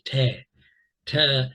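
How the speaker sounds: "te" with a short clear e, as in "tell"; short E demo. Shown as a demonstration. Teacher modeling the short E for learners. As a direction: say "te" crisply, short vowel. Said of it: "That's a short E".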